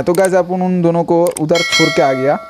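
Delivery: ordinary talk, nothing marked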